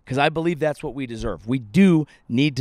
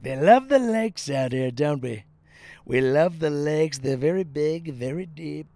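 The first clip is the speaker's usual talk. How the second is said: lightly voiced Trump. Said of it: "and he was like".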